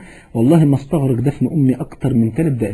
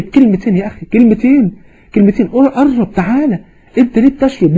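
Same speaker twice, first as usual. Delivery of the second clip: raised, higher-pitched sermon appeal; impassioned preaching exhortation; 4 semitones higher, 3 dB louder than usual. Real, not acted. Preacher urging listeners to return to God, impassioned exhortation. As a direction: urge the listeners, pressing each phrase home.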